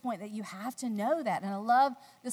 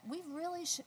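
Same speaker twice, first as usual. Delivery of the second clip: softer, calm and steady; husband calmly warning his wife to stay near; 7 semitones higher, 7 dB quieter than usual. Retold or imitated. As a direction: soothe her with a quiet steady voice. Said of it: "he's being very calm".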